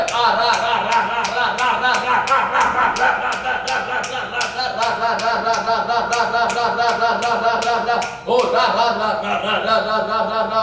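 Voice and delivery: forceful pulsing syllable chant; praying in tongues. Real, not acted. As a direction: pump out one syllable rhythmically without stopping. Chants syllables while exorcising. (ज़ोर से लयबद्ध अन्य भाषा का जाप)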